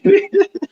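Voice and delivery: sharp high-pitched chuckle; high mocking laugh. Real, not acted. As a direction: laugh at them, high and quick. Mocking the sixty-day ultimatum.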